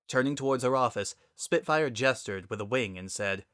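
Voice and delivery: ordinary delivery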